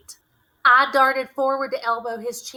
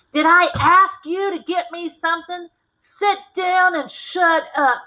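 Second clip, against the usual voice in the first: loud, higher, menacing bellow; shouting; 8 semitones higher, 7 dB louder than usual. A narrator's reading. Drunk father shouts down the women, loud and menacing. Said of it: "he shouted".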